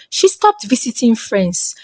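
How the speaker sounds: ordinary delivery, nothing marked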